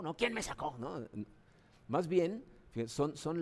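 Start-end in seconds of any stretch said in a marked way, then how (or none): 0.2-0.8 put-on higher, strange little baby voice, indignant; a hammed-up cranky baby; mock angry newborn. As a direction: play a newborn grumbling at being pulled out.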